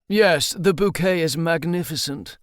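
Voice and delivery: mock-refined British wine snob; a hint of a plummy toff sniffing wine; posh accent. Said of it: "Hutch used a posh accent".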